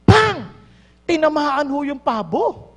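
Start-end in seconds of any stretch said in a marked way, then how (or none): 0.1-0.5 loud "Pang!" bang; short spoken gunshot. Retold or imitated. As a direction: fire the shot with your voice. Gunshot sound effect.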